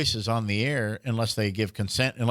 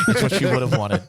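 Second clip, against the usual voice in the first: louder, laughing through the line; 4 dB louder than usual. Real, not acted. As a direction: repeat the joke cracking up. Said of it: "Talk about it like she's dead".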